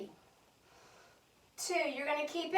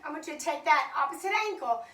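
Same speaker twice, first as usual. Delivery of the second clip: normal talking voice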